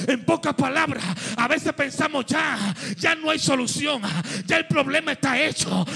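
loud, half-sung preaching over music; fervent sermon cry; full-force preaching. Acted. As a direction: preach it out, rising to the promise.

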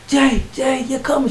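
very high, imitated ecstatic sister; high-pitched retold frenzy; frantic excitement. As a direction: squeal the news like an overjoyed sister. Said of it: "she's going crazy".

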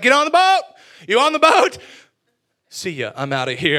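loud, high, hurried orders; loud breezy exit; brusque loud Jesus. Acted. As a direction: bark them onto the boat and leave. Jesus voiced hurrying off.

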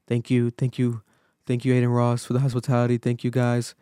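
low, subdued robotic monotone; robotic, socially awkward. Retold or imitated. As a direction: imitate a stiff, awkward guest mumbling thanks. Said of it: "Like, very robotic, like, almost, like, socially awkward".

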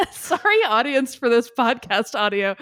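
high, loud, laughing through the sorry; laughing, embarrassed sorry to the audience; laughing apology. Real, not acted. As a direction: giggle out an apology to the listeners.

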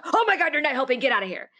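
a retold outburst, mock frustrated; freaking out. Telling her hovering mother to leave, mock snapping. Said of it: "I freaked out".

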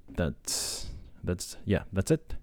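ordinary delivery

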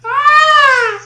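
piercing high mimicked scream; imitated scream. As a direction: shriek like the scream heard in the night. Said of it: "all you hear is a".

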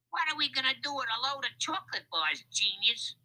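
fast, high crook voice, sarcastic; demanding. A narrator's reading. Lefty blames Louis for the mistake, demanding. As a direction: demand it in a rush, irate. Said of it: "Lefty demanded of Louis".

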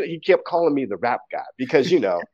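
ordinary talk

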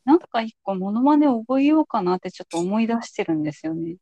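normal talking voice

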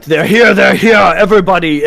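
loud, high alarm call; guards yelling out. Acted. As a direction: raise the alarm to the whole town. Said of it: "guards start to yell out".

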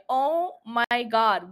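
usual speaking voice

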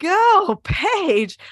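high, loud cheer, stretched GO; admiring cheer. Retold or imitated. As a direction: cheer on a friend with a big high shout. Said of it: "and I'm like".

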